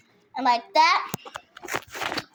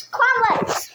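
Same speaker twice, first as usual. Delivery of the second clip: excited burst; 9 semitones higher, 4 dB louder, slower than usual. Real, not acted. Sudden excited announcement.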